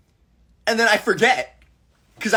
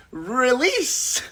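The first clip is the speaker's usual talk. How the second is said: mock dramatic; 7 semitones higher than usual.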